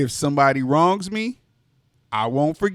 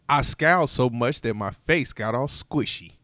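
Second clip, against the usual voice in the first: usual speaking voice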